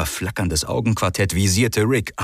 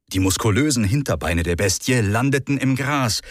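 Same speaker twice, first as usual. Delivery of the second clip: plain reading voice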